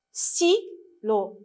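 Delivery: "Stylo" as STY, pause, LO; syllable by syllable. Shown as a demonstration. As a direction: split "Stylo" into two separate beats. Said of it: "lisez avec moi".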